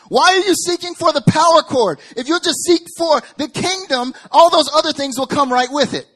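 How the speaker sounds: high, very loud, pleading exasperation; exasperated shout. Acted. Heaven to misguided seekers, high exasperated shout. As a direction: shout it down in disbelief.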